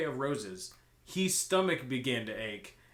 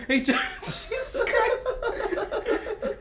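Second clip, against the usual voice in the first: breaking into laughter mid-line; much higher, slow and laughing; 7 dB louder, much slower than usual. Real, not acted. Trying to read a line aloud but cracking up laughing. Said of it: "laughing attempt at line".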